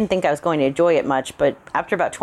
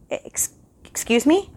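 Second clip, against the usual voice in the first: high, slow, stammering shock; startled stammer; 12 semitones higher, slower than usual. A narrator's reading. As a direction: caught off guard, stumbling over the words. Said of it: "I stammered".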